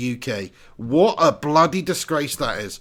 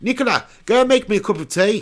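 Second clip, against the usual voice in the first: higher-pitched, hammed-up South African accent; mock South African accent; 6 semitones higher than usual. Teasing girlfriend for tea, mock South African accent. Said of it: "She sounds South African".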